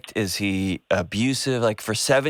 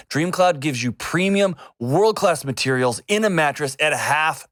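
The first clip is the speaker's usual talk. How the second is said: upbeat sponsor read, a bit higher; 5 semitones higher than usual. Acted. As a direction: sell the mattress with energy. Host reads mattress ad.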